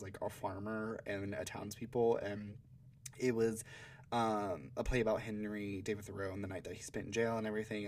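ordinary talk, nothing marked

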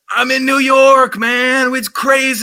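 high excited party guy; hyped clubgoer voice; excited New Yorker. Pretend. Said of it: "you're gonna be like".

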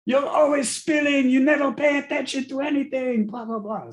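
high, loud, hammed-up scolding dad; mock yelling dad. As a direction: ham up the dad's ranting scold. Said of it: "he'd be like".

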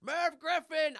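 high, loud screamed name; screaming. Acted. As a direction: scream it at the top of your lungs. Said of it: "they're screaming".

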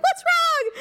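high, concerned mom voice; mock worried mom. Mom asking her upset daughter, high and worried. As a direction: ask it high like a fretting mother.

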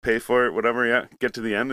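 ordinary talk, nothing marked

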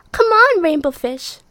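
high, loud calling fish voices. Acted. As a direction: call out brightly to a friend. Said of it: "they would call".

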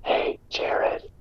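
hushed mock-ASMR whisper; soothing ASMR whisper. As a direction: whisper the insult soothingly, close to the mic. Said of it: "the soothing A S M R voice".

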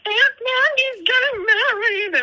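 high, slow, mock-tearful wail; imitating teary announcement. Friend mimics her crying to the daughter, teasing. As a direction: mimic a friend crying over happy news. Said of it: "And you're like".

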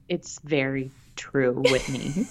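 low, drawn-out mock ASMR; slow right down for comic effect; teasingly slow and deep; playfully slow. Repeats it in slow ASMR joke.

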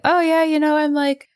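high, airy retold small talk; breezy chat. Retells own breezy chat while shopping.

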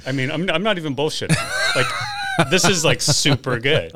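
loud, raving about the taste; excited. Real, not acted. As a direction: gush with real delight.

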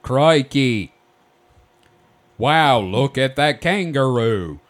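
loud, hammed-up Aussie exclamation; mock Australian accent. Host joins the bad accent contest, mock Australian accent. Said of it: "neither of you can do an Australian accent".

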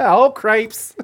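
"Oh, cripes." laughing, with a hammed-up Midwestern "o"; mock Midwest accent. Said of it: "that accent you have".

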